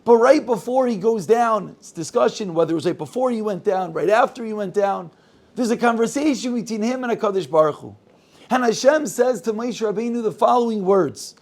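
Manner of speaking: ordinary delivery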